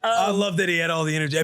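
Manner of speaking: normal talking voice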